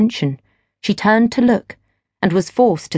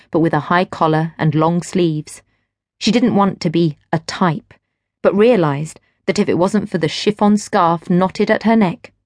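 ordinary delivery

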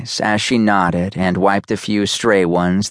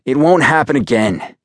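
higher, emphatic vow; insistent, raised-pitch promise; swearing a promise; 6 semitones higher, 4 dB louder than usual. A narrator's reading. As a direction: swear it firmly, pitch lifted. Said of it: "I swore".

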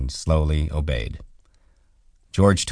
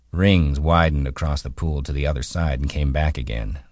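ordinary delivery

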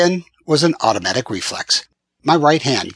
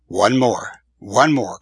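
usual speaking voice

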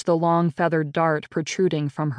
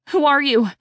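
high, louder panicked question; frightened question; 10 semitones higher, 4 dB louder than usual. Acted. Dizzy captive girl asking the killer his name, afraid. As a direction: ask it in terror, pitch shooting up.

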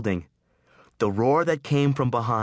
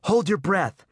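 higher, urgent harsh order; 10 semitones higher than usual. Acted. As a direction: bark the instruction over the fire. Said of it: "he said harshly".

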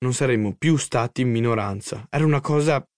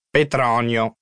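yelling while munching; slower than usual. A narrator's reading. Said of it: "urlò papà dal salotto masticando noccioline".

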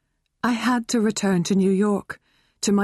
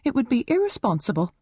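prim; 6 semitones higher, faster than usual. Acted. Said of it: "she said primly".

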